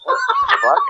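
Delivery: sudden high yelp of shock; a bat sprays your eye, high startled yelp; shocked outburst. Retold or imitated. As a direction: react as if something just hit your eye. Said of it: "And I'm like".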